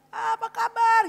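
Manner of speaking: high, loud how-are-you; loud, high-pitched hello; hearty greeting. Acted. Acted greeting on meeting.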